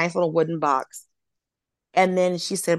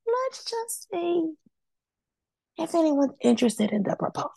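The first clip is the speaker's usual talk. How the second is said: singsong mock coaxing; 12 semitones higher, 3 dB quieter than usual. Retelling agent's coaxing reply.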